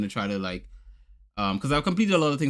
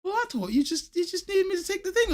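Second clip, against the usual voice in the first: put-on high, innocent kid voice; lightly mocked child excuse; imitating son. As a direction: play the clueless boy, pitched way up.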